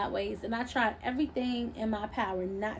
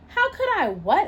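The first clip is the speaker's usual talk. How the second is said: much higher and louder, baffled question; baffled how could I what; confused; 10 semitones higher, 7 dB louder than usual. Acted. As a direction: thrown by the accusation. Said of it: "slightly confused by her question".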